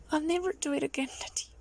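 ordinary talk

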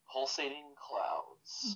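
slow whispered, dramatic announcement. Acted. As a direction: breathe out pulsating clouds slowly. Announcing the next question set.

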